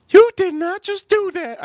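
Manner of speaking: shrill mock-Southern aunt, scandalised; aunt's outraged squeal. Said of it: "She goes".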